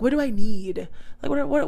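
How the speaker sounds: ordinary talk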